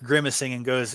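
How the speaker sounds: usual speaking voice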